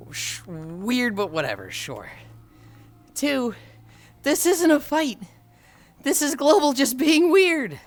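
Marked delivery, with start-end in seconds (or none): 3.1-7.8 high, loud, insistent; exasperated protest. Real, not acted. Streamer denying a fight with a chatter, exasperated. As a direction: raise pitch and volume in protest.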